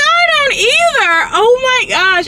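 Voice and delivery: high, loud sobbing wail; retold sob, voice cracking high and loud; crying out. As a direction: wail it through tears, pitch breaking high. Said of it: "And I started crying".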